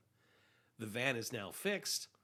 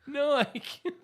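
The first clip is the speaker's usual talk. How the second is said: high mock despair; 12 semitones higher, 6 dB louder, slower than usual.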